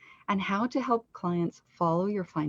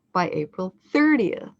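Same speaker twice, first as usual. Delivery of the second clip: By April leads up to a loud thirtieth; end-of-phrase stress; 5 dB louder than usual. Shown as a demonstration. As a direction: punch thirtieth louder at the end. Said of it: "that's the end of the phrase".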